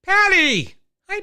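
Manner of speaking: excited greeting. Real, not acted. Greets a guest arriving.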